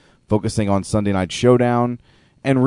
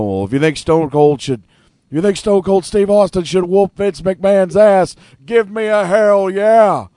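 louder, higher wrestling promo yell; Stone Cold promo voice; 8 semitones higher, 4 dB louder than usual. Retold or imitated. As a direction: rile up the crowd like Stone Cold. Imitating Austin hyping the crowd.

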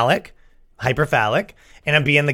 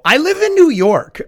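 high, loud disbelief; mock outrage, voice cracking high; incredulous; 13 semitones higher, 3 dB louder than usual. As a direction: squeak it out in disbelief. Incredulous reply to mom.

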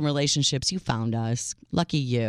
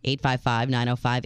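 ordinary talk

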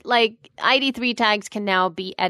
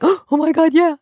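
excited; 7 semitones higher, 8 dB louder than usual. Retold or imitated.

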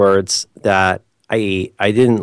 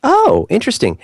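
ordinary talk, nothing marked

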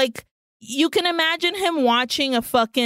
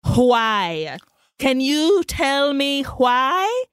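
slow, drawn-out melodramatic pleading; mock pleading Elizabeth; much slower than usual. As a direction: beg for an answer, hammed up. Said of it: "Elizabeth is like".

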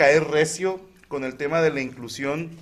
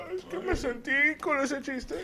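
much higher mocking whiny whimper; 11 semitones higher, 7 dB quieter than usual. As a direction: parody an oversensitive snob. Mocking touchy comedians.

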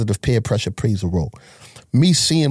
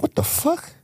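shocked disbelief; 5 dB quieter than usual. Retold or imitated. Finds trap house emptied.

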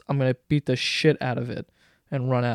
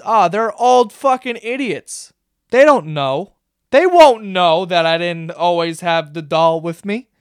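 drawn-out, louder, dismissive mock heroine; cocky mock scheming; 5 dB louder than usual. Heroine scoffing at the old couple's rules, cocky and mocking. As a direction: play her smugly sure she'll get away with it.

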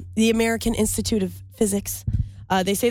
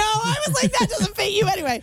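high, laughing tease; 11 semitones higher than usual. Real, not acted. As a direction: laugh through the words, pitched way up. Laughing at his masculinity remark.

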